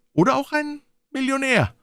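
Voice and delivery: high-pitched, sceptical jab; suspicious. Acted. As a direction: challenge him, voice way up. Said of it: "entgegnete argwöhnisch der andere". (argwöhnisch und hoch)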